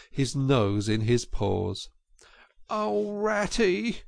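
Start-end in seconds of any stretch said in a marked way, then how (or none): 2.7-4.0 high, slow, dismayed cry; cried in dismay. A narrator's reading. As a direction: wail his name in despair. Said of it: "he cried in dismay".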